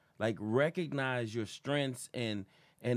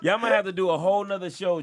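louder and higher, laughing; 5 semitones higher, 7 dB louder than usual. Real, not acted. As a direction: push it up high through laughter. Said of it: "voice going high as fuck".

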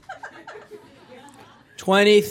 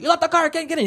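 imitating angry dad; 12 semitones higher, much faster than usual. Pakistani dad yelling at his daughter over the phone, high. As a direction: bark at her like an upset immigrant father. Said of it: "He's like".

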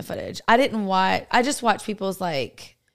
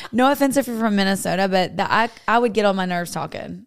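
ordinary talk

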